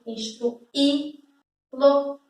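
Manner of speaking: "instruí-lo" very slow, the Í set apart and stressed. Shown as a demonstration. Teacher showing the accented vowel, slow stressed Í. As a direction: draw out "instruí-lo", landing on the Í before lo. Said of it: "ele é acentuado".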